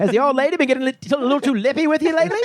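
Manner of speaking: put-on high, fast old-timey pitchman; mid-Atlantic accent. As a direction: gossip like a 1920s ad man.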